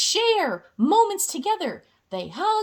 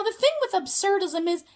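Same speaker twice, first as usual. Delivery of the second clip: ordinary delivery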